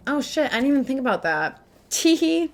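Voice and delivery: sheepish, giggly impression of Aruha. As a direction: play it like a caught-out kid. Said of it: "Aruha's like".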